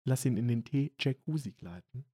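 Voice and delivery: hushed and low-key; soft ASMR voice. Pretend. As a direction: speak softly as if for ASMR. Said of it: "Bisschen A S M R".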